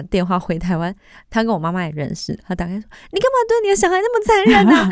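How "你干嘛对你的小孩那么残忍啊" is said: high, loud, fast telling-off; indignant scolding. Retold or imitated. Friend's mother phoning her mom in Taiwan, loud indignant scolding. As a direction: tear into her for being so cruel.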